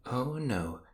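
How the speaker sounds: normal talking voice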